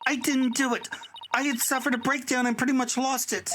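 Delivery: high-pitched distressed testimony; emotional student on the witness stand; emotional performance. Acted. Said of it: "what an emotional performance".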